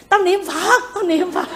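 high, half-laughing mock snap; mild imitation of a dad calling back, high and amused; father's retort. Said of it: "Ba con la".

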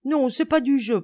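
put-on high, loud boyish objection; indignant protest. A narrator's reading. Boulot protesting to Lebrac during the game, indignant. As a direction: protest against unfair play. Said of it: "protestait Boulot à Lebrac".

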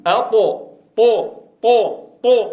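teacher showing an elevated letter; taa demo. Shown as a demonstration. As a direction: drag out طو with a full heavy taa. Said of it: "كما ننطق فنقول".

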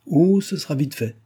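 high, eager outburst; exclaiming. A narrator's reading. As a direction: blurt it out impatiently. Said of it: "s'écria Nicole".

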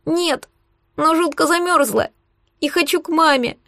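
put-on high-pitched child close to tears; thin, plaintive child; small wavering voice. A narrator's reading. Freezing child wants mum, small tearful voice. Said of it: "Голосок дрогнул, кажется, она заплакала".

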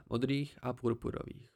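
ordinary delivery, nothing marked

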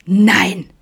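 outraged, loud gasp of a no; shocked, scandalised outburst; indignant. Acted. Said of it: "empörte sich Vincent". (empörter, lauter Ausruf)

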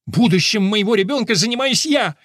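high-pitched indignant outburst; outraged mother bursting in; flaring up. A narrator's reading. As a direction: stake your claim furiously. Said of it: "взвилась мама".

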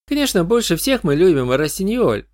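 put-on high, adoring young fan; gushing goth teen; goth girl voice. Goth girl names her idol.

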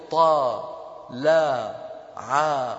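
spelled out slowly. Shown as a demonstration. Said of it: "لأن نطقته أنا أمامكم".

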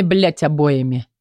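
usual speaking voice

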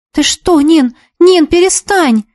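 high, voiced plea with a touch of fright; frightened whisper. A narrator's reading. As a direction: plead anxiously, hinting at a whisper. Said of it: "испуганно зашептала Ика". (испуганная мольба)

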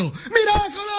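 very high shouted cry of miracle. Acted. The angel tells the police, high shout. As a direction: burst out with a shrieking high cry.

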